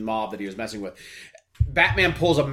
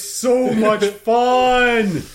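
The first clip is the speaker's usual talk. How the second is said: excited; 7 semitones higher, 5 dB louder, much slower than usual. Real, not acted. Enthusing about Adam West.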